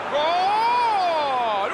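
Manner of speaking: high drawn-out goal cry; goal shout. Acted. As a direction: stretch the goal call. Goal call. (grito de gol)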